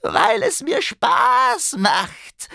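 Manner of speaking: high, slow shouted goblin taunt; cheeky goblin. Acted. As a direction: crow it out impudently. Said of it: "antwortete der Kobold frech".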